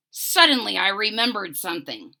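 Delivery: usual speaking voice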